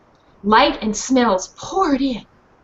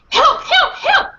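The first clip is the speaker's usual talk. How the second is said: high, loud cries of help; calling for help; 14 semitones higher, 4 dB louder, slower than usual. Acted. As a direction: shout for help like a rescue. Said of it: "repeat after me".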